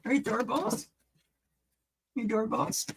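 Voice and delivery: pet talk. Real, not acted. Greeting the pet doorbells, in high soft baby talk.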